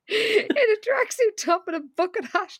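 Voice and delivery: can barely get the words out for laughing; real laughter at the absurd outfit. Real, not acted. Cracking up at Jessica's outfit.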